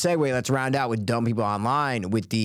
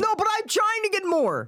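exasperated protest. Real, not acted. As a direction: voice leaping very high in protest. Exasperated protest in friendly argument.

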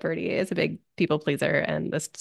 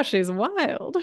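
high, drawn-out put-on voice; slow impression with a lifted pitch; hammed-up character impression; 6 semitones higher than usual. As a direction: stretch it out slowly in an exaggerated cartoon voice. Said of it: "That's a good impression".

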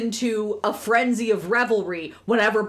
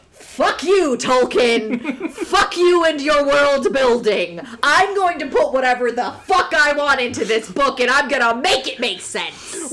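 loud, defiant imitation tirade; mock-angry Lewis rant; 3 semitones higher, 6 dB louder than usual. C S Lewis telling off Tolkien, loud mock-angry rant. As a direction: declaim it loudly at a rival writer, hammed-up. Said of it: "reads like C S Lewis going".

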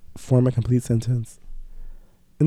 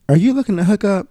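higher, teasing come-on voice, mocking a hookup opener; mock pickup line; 8 semitones higher, 5 dB louder than usual. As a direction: play the sleazy opener higher than his own voice. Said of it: "that's why I say".

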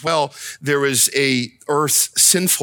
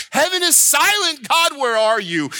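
high, impassioned cry to heaven; anguished appeal; 9 semitones higher than usual. Acted. Hurting families questioning a silent God, impassioned crying out. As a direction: start high and plead, falling on the question.

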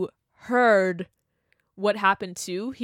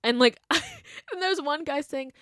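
ordinary talk, nothing marked

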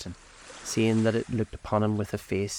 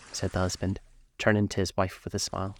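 usual speaking voice

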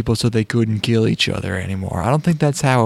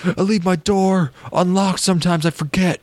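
naive pedestrian; 7 semitones higher than usual. Pretend. Voicing pedestrian in joke.

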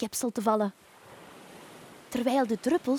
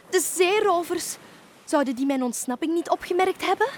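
higher cry, then hurried question; alarmed witch; 7 semitones higher, 4 dB louder than usual. Acted. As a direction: worry that the escape has been noticed. Fleeing witch fears the pirates. (geschrokken, bezorgde heks)

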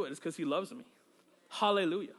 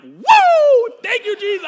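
much higher and louder, a burst of praise; joyful shout; 17 dB louder, slower than usual. Retold or imitated. Preacher reliving the moment of joyful praise, shouting. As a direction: let a whoop of joy burst out.

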